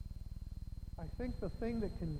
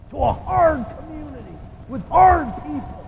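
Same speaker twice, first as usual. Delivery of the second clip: Ron; 15 dB louder than usual. Retold or imitated. Preacher imitating a friend praying in the office, booming voice. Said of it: "start praying in that deep voice of his".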